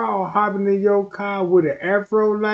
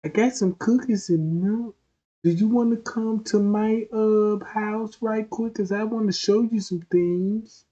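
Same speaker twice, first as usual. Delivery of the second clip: higher put-on voice, lightly done; a halting, higher mock-sweet invitation; creepy come-on act; 6 semitones higher than usual. Put-on stranger offering cookies and milk, creepy come-on.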